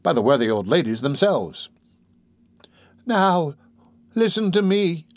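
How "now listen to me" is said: high deathbed voice, making an effort; dying woman aloud. A narrator's reading. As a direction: summon your last energy, pitched high. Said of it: "said the dying woman aloud".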